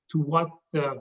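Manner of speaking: ordinary delivery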